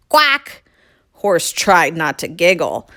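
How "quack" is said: single high quack; quacking cow. Acted. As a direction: demonstrate one alarmed quack.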